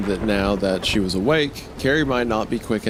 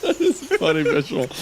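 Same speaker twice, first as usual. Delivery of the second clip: normal talking voice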